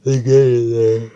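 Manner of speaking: words stretched by a yawn. Real, not acted. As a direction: say it mid-yawn. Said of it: "I am so sorry I'm yawning".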